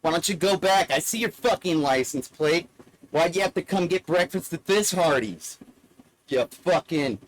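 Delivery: loud mock telling-off; acted loud rebuke; imitated angry father. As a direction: play a father scolding out-of-towners, loudly. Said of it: "And then my father says".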